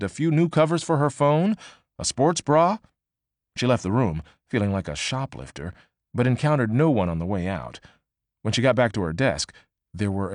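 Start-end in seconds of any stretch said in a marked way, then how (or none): none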